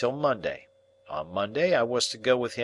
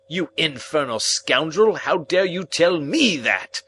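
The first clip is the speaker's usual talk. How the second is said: loud, higher furious rebuke; irate scolding; 6 semitones higher, 5 dB louder than usual. Acted. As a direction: tear into the client. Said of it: "became most irate".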